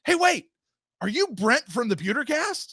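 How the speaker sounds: surprised fan. Retold or imitated. Retelling a recognizing fan.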